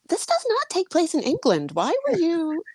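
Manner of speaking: high, disbelieving mock husband voice; incredulous husband. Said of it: "he's like".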